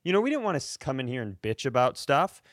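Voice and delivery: usual speaking voice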